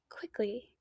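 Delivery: whispering while hiding. Real, not acted. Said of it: "I'm hiding".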